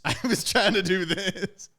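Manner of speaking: high, loud, drawn out through laughter; slow, laugh-filled retold admission; laughing confession. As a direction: crack up while owning up to it. Laughing at his own play.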